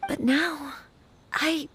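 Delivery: catching on tears, then a sigh; a bride's doubts cracking her voice; voice breaking. Acted. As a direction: falter as if about to cry.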